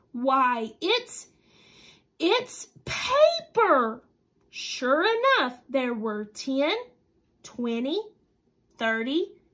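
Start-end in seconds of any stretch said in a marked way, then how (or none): 0.1-4.0 excited discovery. Acted. Child discovering the paper.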